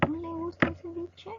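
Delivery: high-pitched incredulous query; surprised question. Shown as a demonstration. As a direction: ask in mild acted disbelief. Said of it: "lo que nosotros expresamos es sorpresa".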